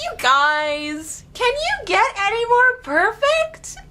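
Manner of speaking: high, swooning squeal; gushing fangirl. Retold or imitated. Fan gushing at the band's charity video, high and swooning. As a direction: swoon over the band. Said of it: "And I was just like".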